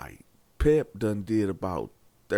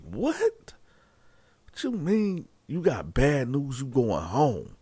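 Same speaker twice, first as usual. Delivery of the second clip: high startled What then pressing questions; shocked disbelief; 4 semitones higher than usual. Retold or imitated. Cellmate reacting to Pep's news, shocked high-pitched disbelief.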